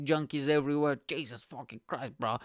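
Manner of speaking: ordinary delivery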